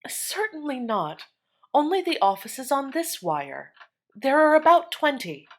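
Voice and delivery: a touch of impatience; impatient. A narrator's reading. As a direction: brush off the question, fed up. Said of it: "was the impatient reply".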